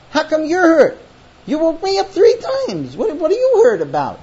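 loud, high-pitched exasperated challenge; indignant. Acted. Husband woken three times to hurt wife, indignant. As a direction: throw the question back at her, incredulous.

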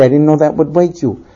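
low, remorseful wife; meek apology. Acted. Wife's apology, husband echoes it.

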